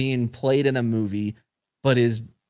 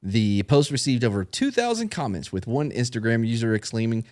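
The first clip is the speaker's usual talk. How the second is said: ordinary talk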